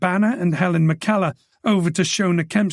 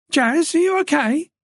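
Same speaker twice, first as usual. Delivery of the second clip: raised, higher voice calling from another room; 12 semitones higher than usual. A narrator's reading. Woman calls to Jazz from inside, worried call. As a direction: call out anxiously to a friend. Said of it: "He heard another voice call out".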